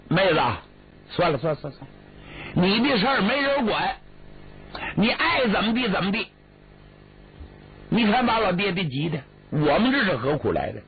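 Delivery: fed up, push her for a yes or no; exasperated pressing. Acted. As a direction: brother losing patience with his weeping sister. Brother loses patience, presses her.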